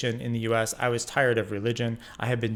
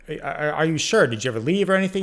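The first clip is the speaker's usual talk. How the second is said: incredulous; 5 semitones higher, 4 dB louder, faster than usual. Retold or imitated. Stunned, questioning Rick.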